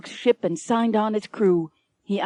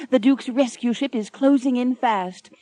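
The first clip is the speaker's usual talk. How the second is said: ordinary delivery, nothing marked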